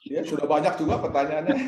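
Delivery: real laughter breaking into the words; answering the moderator's joke with a laugh. Real, not acted. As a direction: chuckle through it, amused.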